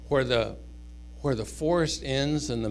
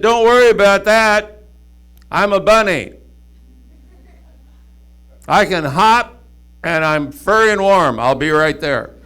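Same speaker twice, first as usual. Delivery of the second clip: louder, higher bunny reassuring from afar; reassuring call; 5 semitones higher, 11 dB louder than usual. Acted. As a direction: call out to comfort him, loud.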